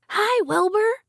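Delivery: put-on high-pitched boy calling out hello; excited high greeting from next door; George's holler. A narrator's reading. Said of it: "George hollered".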